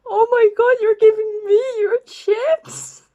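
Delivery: high, loud teary disbelief; mock weepy. Crying girl thanking a stranger for chips, high loud teary. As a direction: blubber over the kindness.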